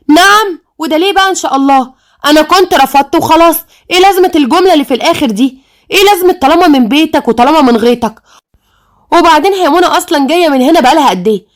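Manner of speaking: high-pitched, affronted protest; indignant outburst. Acted. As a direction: demand answers, scandalized and high. Rejected suitor now chooses her sister.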